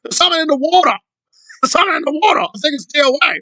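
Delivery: high, hammed-up British accent; mocking British impression. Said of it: "does Aaron's talking points with a accent".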